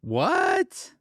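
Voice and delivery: high, incredulous "what"; shocked disbelief. Real, not acted. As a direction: react with real shock, pitch jumping up. Reacts to cabbie refusing passenger.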